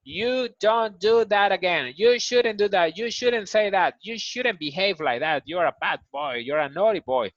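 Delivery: put-on high, loud scolding of a naughty kid; scolding a child. Teacher scolding a naughty boy, high and loud. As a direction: tell him off like a cross parent. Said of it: "when you do something bad".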